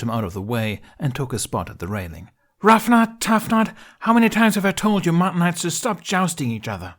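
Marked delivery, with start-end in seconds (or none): 2.6-6.9 high, loud exasperated shout; angry yell. Acted. Astrid yelling down at the jousting twins, furious. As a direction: scold them from far above. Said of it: "Astrid yelling at twins".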